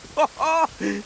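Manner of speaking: a high, delighted laugh; gleeful laugh. Acted. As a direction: laugh with triumph. Finds a pile of beetles.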